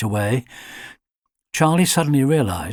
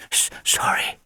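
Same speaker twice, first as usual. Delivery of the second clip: stammered whispered apology; whispered stammering sorry; 5 dB quieter than usual. Acted. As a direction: breathe out a tiny scared sorry. Alfie calms himself.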